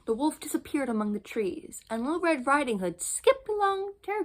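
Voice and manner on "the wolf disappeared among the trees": ordinary delivery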